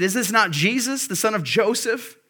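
scoffing crowd. Retold or imitated. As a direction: sneer at him like a doubting neighbour. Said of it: "They said".

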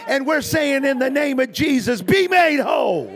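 loud, high preaching prayer; fervent shouted prayer. Acted. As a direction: cry it out to God at full force. Prayer at full force.

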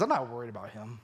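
quiet, offhand dismissal; a hint of casual calm; unbothered. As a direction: shrug it off softly. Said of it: "He says".

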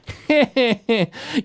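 high mocking villain cackle; villain laugh. Murdoch laughing down at the party, high mocking cackle. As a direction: laugh at the heroes' defiance.

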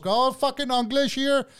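high, slow put-on voice; mock tearful outburst. Crying coworker pulling the race card, mock outburst.

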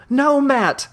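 high, pleading shout of No. Acted. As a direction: cry out to stop him. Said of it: "comes a shout".

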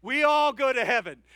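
booming corrected line; emphatic correction. Shown as a demonstration. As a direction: fix the slip loudly with a grin. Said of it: "Sorry, I misspoke".

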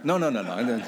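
laughing it off; chuckling through the denial. Real, not acted. Laughing denial after joke.

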